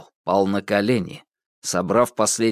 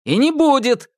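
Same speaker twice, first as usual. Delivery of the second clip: defiant cry; 4 dB louder than usual. A narrator's reading. Ivan defies Koshchei.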